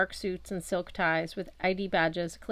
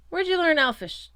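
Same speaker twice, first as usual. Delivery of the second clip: much higher, louder sneer; sneering cop; 10 semitones higher, 6 dB louder than usual. A narrator's reading. Elf cop mocks the human boy, high sneering voice. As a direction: look down your nose at him.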